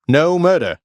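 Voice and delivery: high, loud insistence; frustrated shout. Acted. Hero shouting at the Fury who calls him murderer. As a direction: yell it in exasperation.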